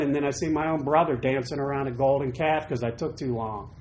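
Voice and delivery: normal talking voice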